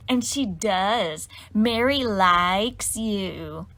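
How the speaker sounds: very slow, drawn-out sing-song tease; slow teasing. Acted. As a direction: stretch each word to embarrass her. Cecilia teasing Mary.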